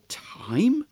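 breathy whispered "Time?"; whispered question. Acted. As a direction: whisper it back in disbelief. Clockmaker's startled question.

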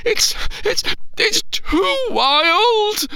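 put-on high, near-tears little boy; panicking child. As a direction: whimper it out as he starts to cry. Boy panicking alone in tent.

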